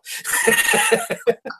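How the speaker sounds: a long high burst of laughter; big laugh. Real, not acted. Cracking up after teasing the fair-weather Hoosier fans with a big laugh. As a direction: let out a big laugh at your own joke.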